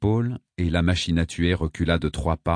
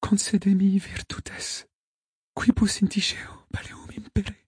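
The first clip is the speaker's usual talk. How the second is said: whispered plea; 13 semitones higher than usual. A narrator's reading. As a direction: whisper it like a prayer. Victim murmurs a Latin plea.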